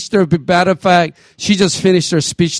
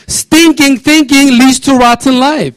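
higher, louder, slow, punching "Stinking"; emphatic repeat; 8 semitones higher, 4 dB louder, slower than usual. Shown as a demonstration. Pastor driving his saying home to the church, slow and forceful. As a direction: preach it out with force, leaning on "Stinking". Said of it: "Let's read this passage out together".

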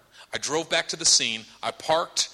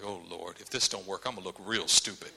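low, hushed aside to God; mumbled inner doubt; worried private prayer; 7 semitones lower, 9 dB quieter than usual. Acted. Preacher privately to the Lord before praying, doubtful.

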